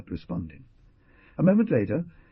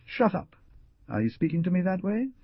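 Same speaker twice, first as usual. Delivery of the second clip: furious retort; 11 semitones higher than usual. Acted. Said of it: "a look bristling with threats".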